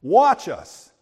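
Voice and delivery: loud, high, slow cocky crowing; triumphant army bragging, loud and slow; shouted boast. Retold or imitated. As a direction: shout it like the win is certain. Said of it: "And they shouted".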